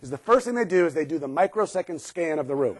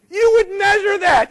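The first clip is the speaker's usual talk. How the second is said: emphatic; 12 semitones higher, 6 dB louder than usual. Real, not acted. Stressing what safety should be.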